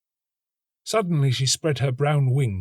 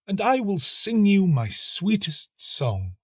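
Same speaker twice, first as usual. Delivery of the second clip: a little higher, earnest plea; pleading nightingale; 11 semitones higher, 3 dB louder, slower than usual. A narrator's reading. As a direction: beg the tree earnestly. Nightingale offers song.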